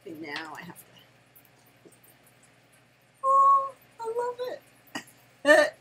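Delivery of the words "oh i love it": very high, loud, drawn-out squeal; delighted squeal. Real, not acted. Grandma squealing over her new gift.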